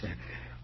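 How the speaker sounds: breathy quiet laugh under the breath; a low private snicker of delight; soft sly chuckle. Acted. Showing off the box, a quiet sly chuckle. Gloating over the hands box.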